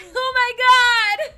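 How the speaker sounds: very high, loud shriek of laughter then drawn-out exclamation. Real, not acted. Host shocked by a guy's ball-sack piercing, shrieking with laughter. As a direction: scream it out in shocked delight.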